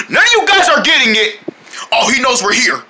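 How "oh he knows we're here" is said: normal talking voice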